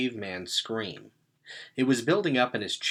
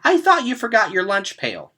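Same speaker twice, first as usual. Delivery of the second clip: put-on high, louder old woman's greeting; relieved grandma; 11 semitones higher, 9 dB louder than usual. A narrator's reading. Confused grandma mistaking Gregor for Simon, high relieved call. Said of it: "grandma talks to "Simon".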